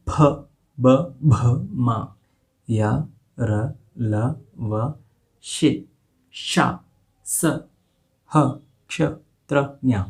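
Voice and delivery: each letter said slowly and distinctly, with pauses; clear letter recitation. Shown as a demonstration. Teacher recites letters for students, slow and deliberate. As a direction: spell out the alphabet for students. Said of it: "एक बार पुनः एक बार बोल देता हूँ".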